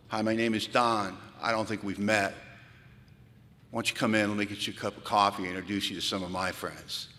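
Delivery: warm welcome. Retold or imitated. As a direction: welcome a stranger in kindly. Welcoming newcomer at door.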